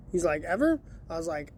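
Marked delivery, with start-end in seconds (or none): 0.4-0.8 incredulous. Retold or imitated. Coworker can't believe it.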